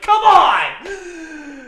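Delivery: loud, high indignant shout. Retold or imitated. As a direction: yell it out in outrage. Incensed shout in dream.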